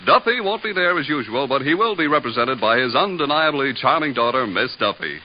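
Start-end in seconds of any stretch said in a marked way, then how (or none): none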